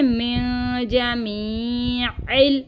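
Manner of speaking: मिन जमीइल slow, with a clear ain. Shown as a demonstration. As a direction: make the ain in जमीइल distinct. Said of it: "आइन को भी वाज़े करेंगे".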